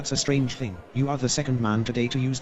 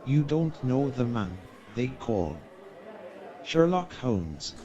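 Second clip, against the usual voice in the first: slow, drawn-out dramatic hush; slow mock-sinister buildup to the name; ominous reveal; slower than usual. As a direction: let the name hang like a dark secret.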